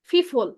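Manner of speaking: ফিফল with f for p, for people; regional mispronunciation. Shown as a demonstration. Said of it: "আপনারা অনেকে উচ্চারণ করেন যে". (আঞ্চলিক টানে people কে "ফিফল" বলা)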